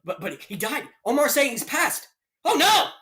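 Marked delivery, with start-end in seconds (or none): 2.4-2.9 sudden high, loud mock dismay; mock shocked cry. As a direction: cry out in fake horror. Fake shock at Rogan news.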